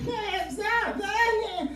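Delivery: tiny high squeal, softly done; soft falsetto girly protest; mock girlfriend. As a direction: imitate a girl being playfully grabbed, high and quiet. Girl being grabbed by boyfriend.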